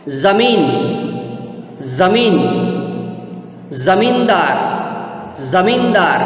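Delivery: ज़मीन and ज़मींदार each begun with a buzzing z; nukta pronunciation. Shown as a demonstration. Teacher models for students, ज़मीन and ज़मींदार with z. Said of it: "मैं कैसे करता हूँ नुक्ता वाले शब्दों का उच्चारण".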